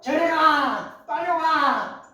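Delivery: high, loud, stretched-out shouts; labor screams. Retold or imitated. Woman in labor yelling at her husband, loud drawn-out cries. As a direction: cry out like a woman in labor pain. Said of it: "고함을 치면서".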